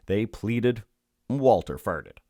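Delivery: usual speaking voice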